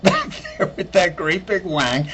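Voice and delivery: ordinary talk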